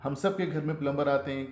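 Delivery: ordinary talk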